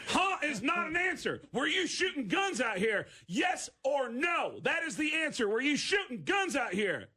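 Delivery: high, forceful scolding, each word driven home; exasperated cop. Retold or imitated. Cop rejecting a dumb answer, exasperated demand. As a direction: imitate an officer demanding a straight answer.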